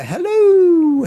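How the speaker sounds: high, loud, drawn-out hello; exuberant greeting. Real, not acted. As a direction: call out a long, high-pitched hello to a friend. Calling out greeting to viewer.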